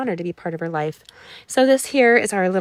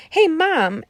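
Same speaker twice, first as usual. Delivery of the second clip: mock yell across the gym, pitched higher; imitated yell; 9 semitones higher, 5 dB louder than usual. Adopted son yelling across the gym to her, a mock yell. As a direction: call out like a grown kid greeting his adopted mom. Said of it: "he yells".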